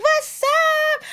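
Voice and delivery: lightly voiced high girl greeting. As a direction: pitch it up for Cardi. Cardi voiced in story.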